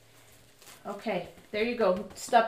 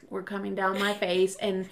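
ordinary delivery

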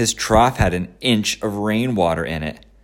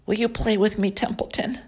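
high, whiny piglet voice; a disappointed young pig; 14 semitones higher, 4 dB quieter than usual. Acted. Wilbur asks the rat.

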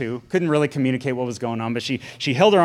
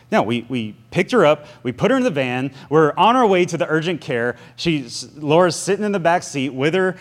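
normal talking voice

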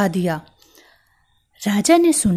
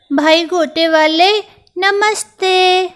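a tiny mouse being extra polite; sweet mouse greeting; 10 semitones higher, 6 dB louder than usual. Acted. Mouse greets the trim seller.

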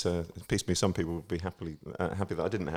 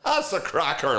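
comedian impression; 6 semitones higher, 4 dB louder, slower than usual. Impression punchline.

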